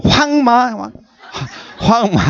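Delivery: high, laughing Gyeongsang "황마"; Gyeongsang fighting talk. Retold or imitated. Pastor mimicking Gyeongsang people quarrelling, high and laughing. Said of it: "막 싸울 때도요".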